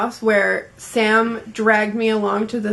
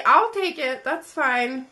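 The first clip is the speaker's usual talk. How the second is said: ordinary talk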